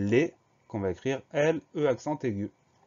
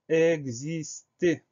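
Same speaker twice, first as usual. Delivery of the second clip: "exister" drawn out in separate syllables, higher pitch; slow dictation; 6 semitones higher, 4 dB louder, much slower than usual. Shown as a demonstration. Teacher announces the next spelling word, slow dictation.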